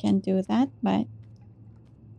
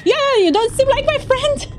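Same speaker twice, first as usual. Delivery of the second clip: excited; 13 semitones higher, 3 dB louder than usual. Real, not acted. Creature seems hostile, mock accusation.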